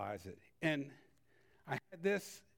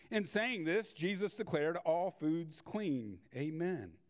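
normal talking voice

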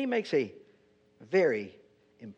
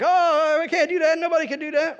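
much higher and louder mock panic; panicky wise men; 11 semitones higher, 5 dB louder than usual. The wise men panicking at the king's demand, hammed-up and high. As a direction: squeal it like a flustered courtier caught out.

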